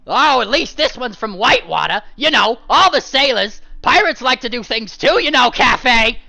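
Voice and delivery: high, loud gruff shout; husband ranting at his wife; loud exclaim. Acted. Husband relieved then mocking.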